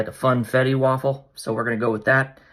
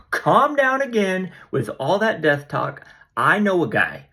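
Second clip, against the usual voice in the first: much higher, slow and laughing, brushing her off; amused high Elijah; 9 semitones higher than usual. Retold or imitated.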